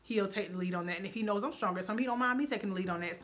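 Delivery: ordinary delivery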